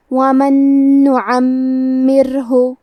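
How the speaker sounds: slow recitation, the ر in نُعَمِّرْهُ light and thin, the م doubled; tajweed recitation. Shown as a demonstration. Teacher demonstrating the rule to students, slow thin ra. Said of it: "ہم یہ حرف کو پتلی آواز میں پڑھیں گے".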